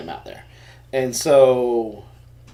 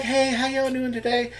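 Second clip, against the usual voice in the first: higher, light waitress greeting; quoting the waitress; 11 semitones higher than usual. Retold or imitated. Waitress greeting father and son at the table, higher and chirpy.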